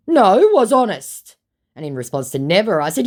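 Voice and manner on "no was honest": high, loud, drawn-out denial; mock protest. Impostor denying that he lied, mock protesting. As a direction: stretch out his hammed-up protest.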